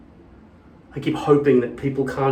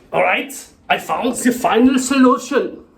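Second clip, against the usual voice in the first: put-on German accent; 9 semitones higher, 4 dB louder than usual. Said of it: "I can't do the accent".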